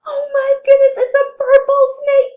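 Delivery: high, loud alarmed shout; mock shock. Teacher shouting in pretend fright at a purple snake. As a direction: cry it out in playful fright.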